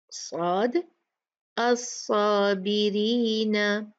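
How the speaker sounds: खालिदीना drawn out slowly with a full heavy alif; slow heavy recitation. Shown as a demonstration. Teacher drills students on heavy-letter words. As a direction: recite each word slowly and thick. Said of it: "अब हम थोड़ी practice करते हैं इनकी".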